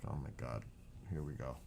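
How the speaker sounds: low, quiet retold exasperation; resigned aside. Retelling a reaction to Mom blaming the lights, resigned aside. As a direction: say it under your breath, resigned. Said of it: "I'm like".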